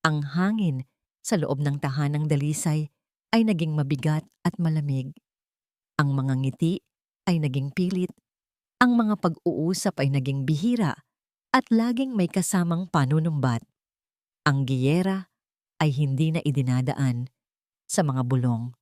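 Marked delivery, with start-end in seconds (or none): none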